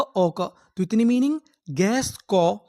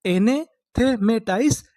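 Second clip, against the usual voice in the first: slow demo; much slower than usual. Shown as a demonstration. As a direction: draw out एनेथेमेटाइज syllable by syllable. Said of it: "तो उससे हम लोग क्या बोलेंगे?". (एनेथेमेटाइज धीरे-धीरे खींचकर)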